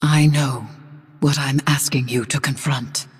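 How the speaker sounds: hushed grave whisper. Acted. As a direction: drop to a whisper of warning. Warning of a hard confrontation.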